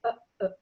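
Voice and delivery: "uh, uh" each cut off in the throat; two "uh"s chopped by a glottal stop. Shown as a demonstration. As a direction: catch the breath after each "uh". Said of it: "in there is the glottal stop".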